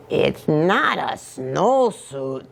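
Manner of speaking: slow, firm declaration; declaring. Acted. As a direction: state it flatly and slowly. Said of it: "declared Scapito".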